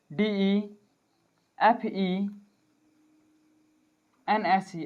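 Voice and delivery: letter by letter, drawn out: डीईएफ़ईएनएसई; slow dictation spelling. Shown as a demonstration. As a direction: correct the spelling slowly, letter by letter. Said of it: "sorry, डीईएफ़ई".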